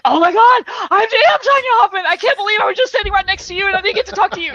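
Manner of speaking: high, fast gushing reply; mock gushing callback. Speaker calling back the fan, gushing high and fast. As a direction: gush back at the fan playfully. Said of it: "I'm like".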